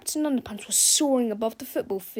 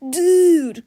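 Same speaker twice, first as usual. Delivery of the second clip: high, much louder "Dude"; loud cry; 7 semitones higher, 13 dB louder than usual. A narrator's reading. Skateboarder sees the hero, crying out loud. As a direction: shout in disbelief. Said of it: "cried one of the skateboarders".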